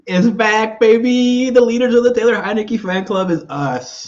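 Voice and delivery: loud, high hyped cheer; fan celebration. Pretend. Co-host celebrating Taylor Heinicke's return, loud high hype. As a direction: shout it like a proud superfan.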